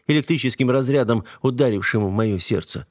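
ordinary delivery, nothing marked

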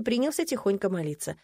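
usual speaking voice

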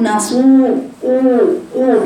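the long u of نسوه thickened towards a heavy o; heavy madd. Shown as a demonstration. Teacher showing the error of a thickened madd, heavy o. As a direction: say نسوه with a rounded, over-heavy o. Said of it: "لأن الصاد قبل الواو أهو".

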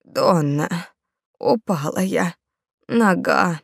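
put-on old woman whispering. A narrator's reading. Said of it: "старуха прошептала".

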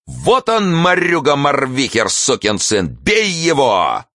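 high cry sliding downward; wailed call to beat him; howling. A narrator's reading. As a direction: howl an accusation, dragging the last words. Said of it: "кто-то взвыл в небесах".

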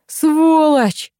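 high, loud shouted insult; outraged, high-pitched holler; furious yell. A narrator's reading. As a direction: yell the curse at someone far off. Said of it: "заорал Фёдор".

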